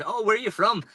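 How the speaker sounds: chirpy Canadian local asking where you're from; Canadian accent. Retold or imitated. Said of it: "they've got the Canadian accent".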